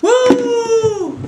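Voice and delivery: loud high whoop of glee; excited whoop. Real, not acted. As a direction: cheer it out high. Cheer before eating worm.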